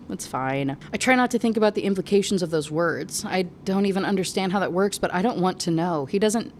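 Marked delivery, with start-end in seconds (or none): none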